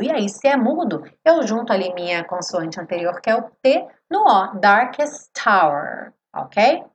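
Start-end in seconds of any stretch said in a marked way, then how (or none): 4.1-6.1 darkest tower said "darkes-tower", T joined to hour; slow "darkest tower" linked; silent H linked. Shown as a demonstration. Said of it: "hour ... o H é mudo".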